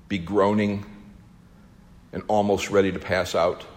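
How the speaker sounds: hushed, heavy-hearted questioning; sombre foreboding. Acted. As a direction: ask it gravely, voice sinking. Father dreads what is to come.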